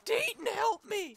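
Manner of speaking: high drawn-out cry for help; calling for help. Acted. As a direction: wail for your teammate, high and slow. Attacked by zombie.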